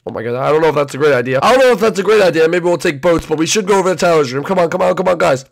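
ordinary delivery

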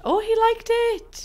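very high, slow delighted coo; delighted squeal. Real, not acted. Gamer cooing at the cow plant for eating meat. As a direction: coo over a pet.

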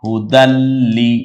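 loud, slow হুদাল্লি with the l said twice; doubled consonant. Shown as a demonstration. Lam is pronounced twice for tashdid, doubled consonant. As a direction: join and hold the ল্ল in হুদাল্লি. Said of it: "দুইবার উচ্চারণ হবে তাসদিদ যেহেতু".

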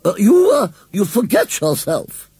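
haughty lady rebuking the scarecrow; snapped. A narrator's reading. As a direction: snap at him like an offended dowager. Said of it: "snapped Missus Bloomsbury Barton".

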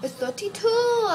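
high, mock-exasperated correction; exasperated insistence. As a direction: insist with the pitch shooting up. Teacher insisting on wrong answer.

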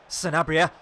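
willing the striker on; rising excitement. Real, not acted. Gamer urging his striker toward goal, eager call.

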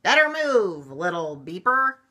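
loud, high street-sweeper shout; hollering. A narrator's reading. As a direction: holler it at the little truck.

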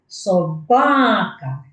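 Собака said slowly with ба stretched and stressed; stress on the syllable. Shown as a demonstration. Teacher showing children the stressed syllable, slow demo. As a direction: draw out the ба in Собака. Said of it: "Ви чуєте, що я виділяю?".

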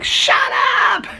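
loud, very high-pitched holler; imitated neighbor's yell. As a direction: yell at a howling dog like an exasperated neighbor. Imitating neighbor yelling at howling dog.

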